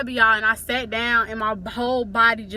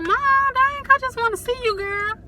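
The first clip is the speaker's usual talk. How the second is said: mock protesting; 12 semitones higher, 3 dB louder than usual. Daughter protesting to mama on the phone, hammed-up complaint. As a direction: plead in an exaggerated high voice.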